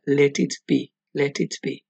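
"let it be" with every t clearly released; careful "let it be", each t crisp; full t sound. Shown as a demonstration. Teacher contrasts the Beatles line with full t's. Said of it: "Si lo pronunciáramos con el fonema".

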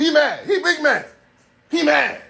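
high, gleeful jeering; mocking taunt. Demonstrates the taunt.

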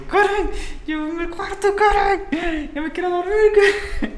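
mucho más agudo, quejumbroso entre risas; quejido suplicante. Retold or imitated. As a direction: suplica como niño asustado. (suplicándole a Karen, agudo y quejumbroso)

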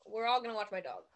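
ordinary talk, nothing marked

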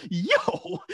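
very high-pitched protest; incredulous. Retold or imitated. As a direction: squeal it in amused disbelief. Shocked reply to teacher's mockery.

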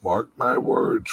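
ordinary delivery

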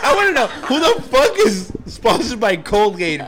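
loud disbelief; incredulous shout. Real, not acted. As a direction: yell it, incredulous. Shocked at Colgate sponsor.

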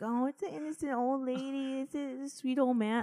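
high, sugary mock sympathy; mock cooing. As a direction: coo it like a naive admirer of the elderly. Mimics people fawning over elderly.

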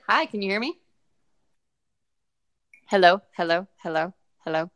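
checking the call connection; calling hello into a dropped call; phone held away. Real, not acted. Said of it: "Tell me when you can hear me".

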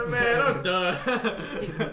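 slow high laughing imitation; laughing mimic. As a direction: echo him through laughter.

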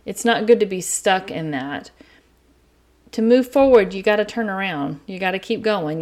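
ordinary delivery

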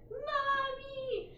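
put-on high whiny child's "Mommy"; mock child whining for mom; childlike wail. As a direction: cry out like a small child. Quoting a TikTok child.

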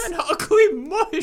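high, slow words through laughter; laughing repeat. Real, not acted. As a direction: savour the phrase while cracking up. Repeats the phrase while laughing.

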